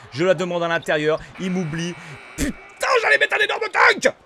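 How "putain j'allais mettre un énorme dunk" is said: very high, loud outburst; frustrated shout. Real, not acted. Player forgotten by teammate under the basket, frustrated shout. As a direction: yell in real gamer frustration. Rate son action en jeu.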